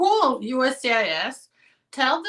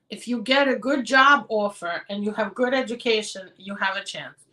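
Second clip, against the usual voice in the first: ordinary talk, nothing marked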